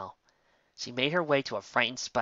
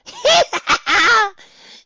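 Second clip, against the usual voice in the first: shrill high hysterical cackle; hysterical laugh; 13 dB louder than usual. A narrator's reading. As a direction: cackle like a madwoman, loud. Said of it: "the yellow one laughed hysterically".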